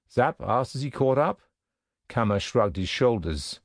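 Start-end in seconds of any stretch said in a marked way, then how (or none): none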